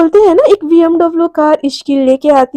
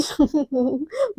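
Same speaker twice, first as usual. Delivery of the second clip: acted ironic laugh; 10 dB quieter than usual. Mishka reacts to talk of luck, ironic chuckle. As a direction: chuckle to yourself at the irony.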